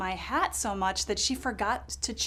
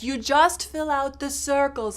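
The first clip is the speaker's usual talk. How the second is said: put-on high, louder, slow accented explanation; European man explaining; 4 semitones higher, 5 dB louder than usual. Poll worker explaining the ballot, high slow accented voice. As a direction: spell it out patiently in the European voice. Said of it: "he's like".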